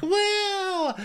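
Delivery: very high mock party cheer; party whoop. Party cam, everyone cheering.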